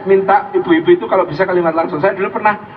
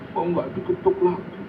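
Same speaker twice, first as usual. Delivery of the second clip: very soft, forlorn wife; mock sad; 10 dB quieter than usual. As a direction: fret quietly, pretending to be heartbroken. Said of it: "dia sedih".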